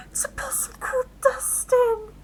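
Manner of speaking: high whispered glee; delighted whisper. Real, not acted. Player thrilled, whispering the possum's name.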